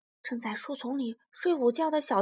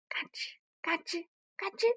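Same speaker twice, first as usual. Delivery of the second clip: crunching sound; 4 semitones higher, 6 dB quieter than usual. Acted.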